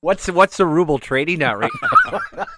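"What's the ruble trading at" asked through real laughter; laughing through a question. Real, not acted. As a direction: let the laugh spill into the joke question. Joking about rubles, laughing.

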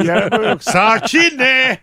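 hammed-up frustration; mock exasperated. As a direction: act like you're losing it.

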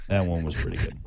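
ordinary talk

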